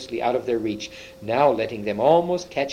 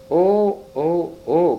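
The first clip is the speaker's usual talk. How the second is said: higher, louder cries of dismay at first, easing off; mild dismayed cry; 4 semitones higher, 5 dB louder, slower than usual. A narrator's reading. Two girls find the stone table empty, dismayed cry. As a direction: cry out in dismay, within a storyteller's reading. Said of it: "cried the two girls".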